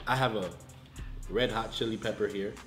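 ordinary talk, nothing marked

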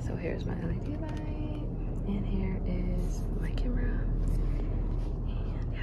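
mostly whispered library voice; not being loud. Real, not acted. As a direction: whisper it, drifting out of voiced speech. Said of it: "I couldn't really be loud".

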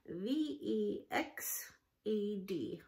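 "V E X E D" slowly letter by letter; spelling out. Shown as a demonstration. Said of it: "word number three".

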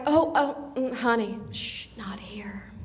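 falls to a slow whisper on "shh, not here"; hushing whisper. Retold or imitated. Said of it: "somebody's like".

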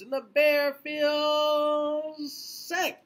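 high, slow sing-song refrain line; drawn-out refrain. A narrator's reading. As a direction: stretch the refrain up high for the kids.

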